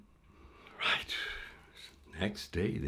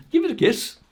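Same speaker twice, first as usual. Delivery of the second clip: teasing; 11 dB louder, faster than usual. Acted. Jesper teasing, amused.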